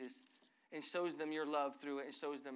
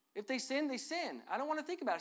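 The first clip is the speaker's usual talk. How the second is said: I don't wanna think about it pitched much higher, fed up; mock fed-up outburst at the gossiper; exasperated refusal; 7 semitones higher than usual. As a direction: push the gossip away, exasperated.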